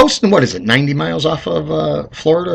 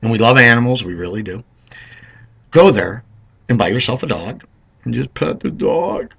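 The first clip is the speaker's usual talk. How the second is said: usual speaking voice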